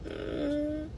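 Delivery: faint hum, barely there; a feeble muffled moan; weak moan. Retold or imitated. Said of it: "'cause I had tubes".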